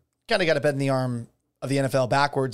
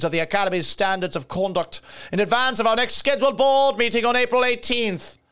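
higher-pitched mock British accent; David Rubin, British accent; 5 semitones higher than usual. Host reading the Academy president's statement, mock British accent. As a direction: read the statement in a put-on, pompous British voice. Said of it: "I gave him a British accent".

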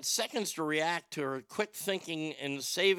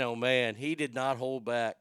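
normal talking voice

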